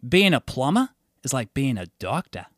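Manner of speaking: a light Australian voice, said matter-of-fact; a hint of an Aussie plumber's pride; Australian accent. An Aussie plumber telling him about his job, a light Australian accent. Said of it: "your Australian accent got really good".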